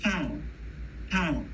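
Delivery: Paul with a strong puff of air on the P; aspirated P. Shown as a demonstration. Teacher demonstrating the English aspirated P. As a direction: breathe out hard on the P of Paul. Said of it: "Listen to the puff of air".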